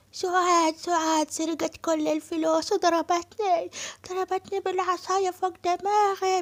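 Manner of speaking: high, slow lamenting nanny voice; wailing old nanny. Acted. Nanny Naima telling Asem that Suad robbed him, high wailing lament.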